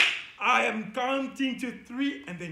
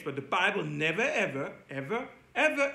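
ordinary delivery

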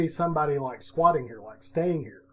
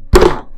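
loud, booming thud noise; imitated thump; 14 dB louder than usual. Storyteller recreating a thump from the back of the house, loud boom.

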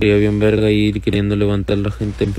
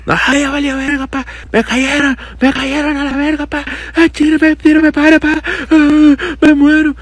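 much higher, louder frantic yelling for help; panicked screaming buddy; 5 dB louder, slower than usual. Retold or imitated. Buddy screams as enemies rush him, panicked high yell. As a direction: shriek like your teammate is getting wrecked. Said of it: "mi camarada gritaba bien culero".

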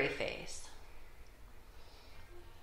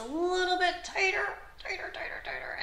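strained whisper; 12 semitones higher than usual. Acted. Building squeeze cue.

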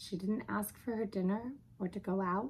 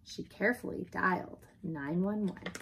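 ordinary delivery

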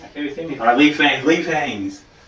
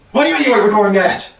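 indignant outburst; 7 semitones higher, 5 dB louder, much faster than usual. Acted. Angry outburst at player.

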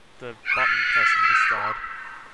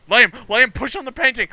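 raised, urgent call; urgent shout; 8 semitones higher, 9 dB louder than usual. Real, not acted. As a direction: call him over, fast.

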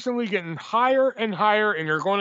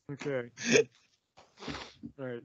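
usual speaking voice

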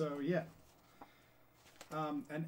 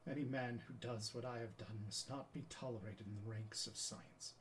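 Galileo, self-condemning. Acted. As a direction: let it fade into grave defeat. Monologue ends in self-condemnation.

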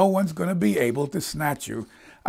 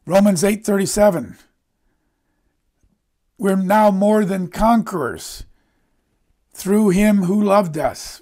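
impassioned preaching; 6 semitones higher, 7 dB louder than usual. Real, not acted. Preacher proclaiming verses of God's love to his congregation, impassioned. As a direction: proclaim it forcefully, stretching the key words.

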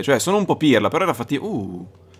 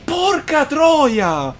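ecstatic shout; 8 semitones higher, much slower than usual. Real, not acted. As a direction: roar it out in disbelieving joy. Boss finally dies. (esultanza incredula)

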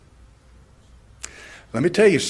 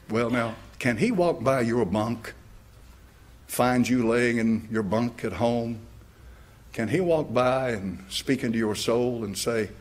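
normal talking voice

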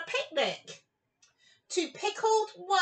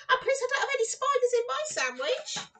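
laughing through the words, quick; giggling plea, no spiders please; laughing while begging off spiders; 8 semitones higher, much faster than usual. Real, not acted.

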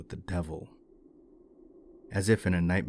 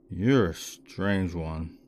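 put-on very slow drunken drawl; slurred, sluggish drunk voice; much slower than usual. A narrator's reading. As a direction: drag the words out like a drunk. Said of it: "the drunken young man said".

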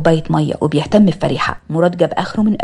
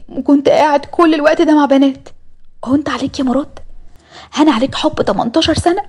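put-on higher, hurt young woman accusing her fiancé; a betrayed girl confronting him, voice raised; upset young woman; 8 semitones higher than usual. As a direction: voice Fariha pitched up and wounded. Narrator voices upset Fariha.

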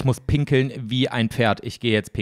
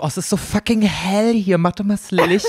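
high mock-innocent voice; higher playful put-on; joking mock line; 9 semitones higher than usual. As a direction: play it as a silly pun. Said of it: "Es wär lustig, wenn du sagen würdest".

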